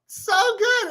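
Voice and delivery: high, slow, put-on gushing voice; delighted gushing. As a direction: squeal it out in a high, mocking voice. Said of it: "died 'cause I was like".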